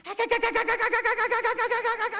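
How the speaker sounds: one long, unbroken imitated gun burst; very high, loud runaway gunfire noise; overexcited machine-gun fire. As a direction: rattle nonstop like a trigger held down. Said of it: "Floyd was a little bit excited".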